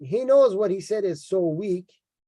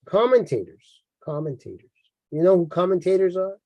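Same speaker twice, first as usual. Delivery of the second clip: ordinary talk, nothing marked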